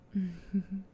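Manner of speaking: gentle amused chuckle. Acted. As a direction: laugh fondly at their yawn. Laughs at listener's yawning.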